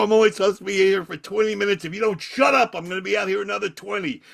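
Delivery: high-pitched, fast retort to hecklers; angry threat. Retold or imitated. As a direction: rattle off a threat to the crowd.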